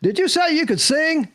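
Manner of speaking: lightly voiced phone caller; agent on the phone. Agent phones actor about a part, brisk caller. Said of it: "goes like this".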